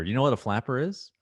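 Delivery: normal talking voice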